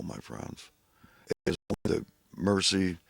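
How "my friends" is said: whispered under the breath. Real, not acted. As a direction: let it fade into a whisper. Fades to whisper.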